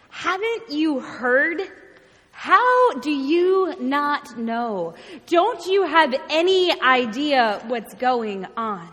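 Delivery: slow, emphatic disbelief; astounded. Acted. Travelers to an uninformed stranger, astounded. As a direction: draw out each question as if astonished. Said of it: "And astounded, they respond".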